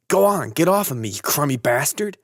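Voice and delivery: put-on furious boy cursing, loud and high; angry yell. A narrator's reading. Holden pinned under Stradlater, furious yell. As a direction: spit the insult up at him.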